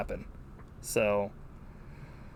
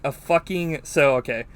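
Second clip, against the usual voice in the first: ordinary delivery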